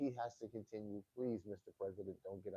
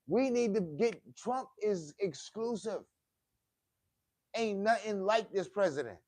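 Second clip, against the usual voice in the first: loud, higher, hammering each phrase; fired-up rally preaching; 7 semitones higher, 11 dB louder than usual. Real, not acted. A fan preaching to his viewers, loud and fired up.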